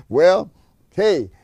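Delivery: usual speaking voice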